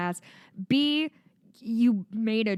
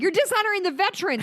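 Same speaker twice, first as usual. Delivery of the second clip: mock outraged critic; 12 semitones higher, 4 dB louder, faster than usual. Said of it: "people are like".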